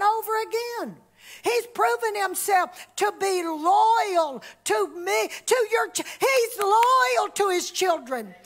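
high, loud preaching build; rising shouted praise to the congregation; fervent preaching. Acted. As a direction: preach it out with full fire. Preaching at full force.

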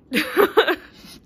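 high, loud burst of laughter. Real, not acted. Teacher joking with parents about late calls, loud laugh. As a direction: laugh out loud at her own joke. Laughs at her own joke.

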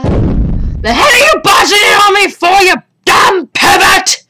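high, loud outraged scolding; angry shout. Acted. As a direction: shout down an intruder, drawing out the insult. Acts bather scolding intruder.

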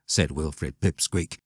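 usual speaking voice

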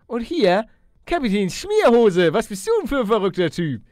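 loud, high excited call of the name. Real, not acted. Player spots the captain and calls his name excitedly.

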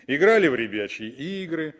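ordinary talk

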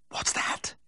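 urgent breathy whisper under the breath; hissed whisper. A narrator's reading. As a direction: hiss it so the farmer can't hear. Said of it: "I hissed".

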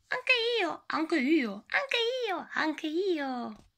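very high excited little voice repeating; eager squeaky echo. Acted. Eager character squeaking agreement about cheese. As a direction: chirp it like an overexcited rodent.

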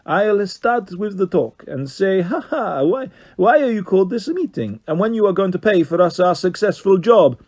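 Carlos Fandango, high and Italian. A narrator's reading. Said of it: "high Italian-voiced Carlos Fandango".

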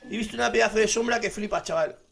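high-pitched, amazed outburst; asombro real. Real, not acted. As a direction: cry out high in astonishment at what just appeared. Asombro ante una sombra enorme. (exclamación aguda de asombro)

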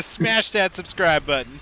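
YouTuber voice. Pretend. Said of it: "like I'm watching a YouTube channel".

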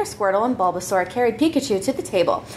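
usual speaking voice

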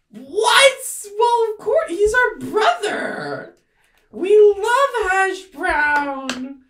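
very high, loud, drawn-out squeal; protesting little creature. Acted. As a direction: squeal in over-the-top innocence. Bully mushroom protests innocence to Ivy.

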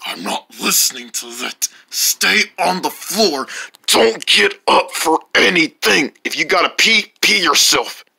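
low stern voice. Acted. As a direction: order him to stay put. Low commanding doctor voice.

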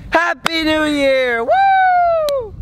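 high, slow mock celebration cheer; new year countdown crowd. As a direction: whoop it up like midnight. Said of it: "you wanna do a countdown".

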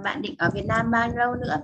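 ordinary delivery

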